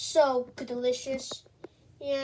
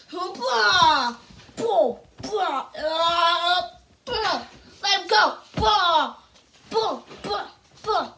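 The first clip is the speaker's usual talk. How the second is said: loud, higher punch yells; fight grunts; 4 semitones higher, 5 dB louder, much slower than usual. Acted. Mario fights the cat, yelling with every punch. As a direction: punch yells in a toy brawl.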